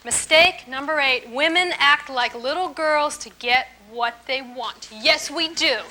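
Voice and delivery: normal talking voice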